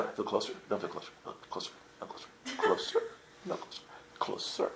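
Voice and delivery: quick, hushed back-and-forth muttering; testing whisper. Retold or imitated. Tourist touching and releasing the cross, rapid hushed testing.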